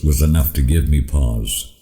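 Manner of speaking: ordinary delivery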